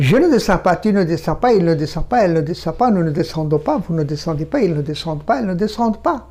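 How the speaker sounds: rapid run through the negative forms; very fast. Shown as a demonstration. French teacher shows how quickly French is spoken, very fast. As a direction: rattle off the French conjugation without pausing. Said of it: "দ্রুত বলতে হবে".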